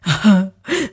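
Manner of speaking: hammed-up burst of sobbing; stagey crying sound; acted sob. A narrator's reading. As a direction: break out in mock wailing. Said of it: "放声大哭".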